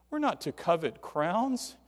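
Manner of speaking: ordinary talk, nothing marked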